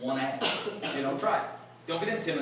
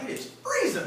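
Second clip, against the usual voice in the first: mock complaint; 14 semitones higher than usual. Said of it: "I'm like".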